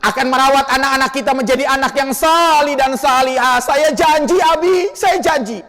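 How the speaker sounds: high, pleading voice of a grieving wife; tearful promise. Acted. Wife promising her dying husband, high and pleading.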